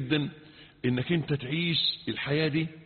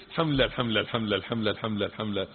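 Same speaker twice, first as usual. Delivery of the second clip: mindless rushed dhikr; 6 semitones lower, much faster than usual. Retold or imitated. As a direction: rattle it off mechanically under the breath. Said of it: "لكن احنا قاعدين نقول".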